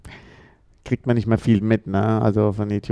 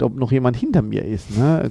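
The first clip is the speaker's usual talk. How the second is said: normal talking voice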